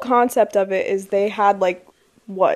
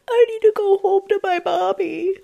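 put-on higher whiny little boy; whiny kid voice; 11 semitones higher than usual. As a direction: whine like a homesick child. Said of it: "he's like".

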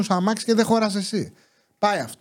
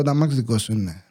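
mock gruff neighbour; 8 semitones lower than usual. Mimicking the shady neighbour asking about the car, lower. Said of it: "ξεκινάει, ξέρεις, να σου πω".

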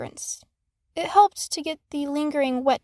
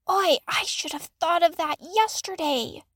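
self-scolding; 3 semitones higher than usual. A narrator's reading. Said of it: "he berated himself".

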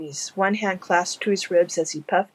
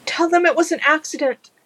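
high, panicked stammer; 11 semitones higher, 5 dB louder than usual. Acted. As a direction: plead in a frightened rush. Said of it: "the man stammered".

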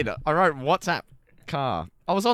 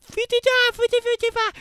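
high mock voice; 6 dB louder than usual. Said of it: "You're like, you're like".

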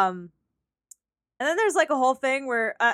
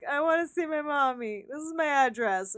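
a hint of a lost, childlike man, a bit softer; childlike zombie man; 3 semitones higher, 4 dB quieter than usual. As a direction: lightly voice him pleading for his mommy. Voicing the bitten zombie man.

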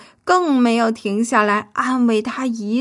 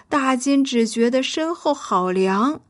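ordinary delivery, nothing marked

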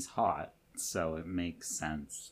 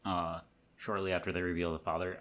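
usual speaking voice

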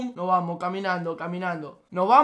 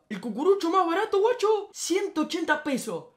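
outraged disbelief; 7 semitones higher than usual. Real, not acted. Outrage at ice cream price.

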